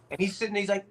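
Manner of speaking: ordinary talk, nothing marked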